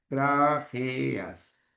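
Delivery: "Grajeas" drawn out in separate syllables; J sound shown. Shown as a demonstration. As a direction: sound out "Grajeas" with hard g, then Spanish j. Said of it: "suena como una J".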